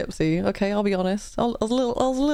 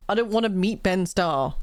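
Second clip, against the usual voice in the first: ordinary delivery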